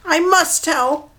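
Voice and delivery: a captive bird giving in; mud hen. Acted. Leader answering the flock, slow high bird voice. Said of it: "the leader answered".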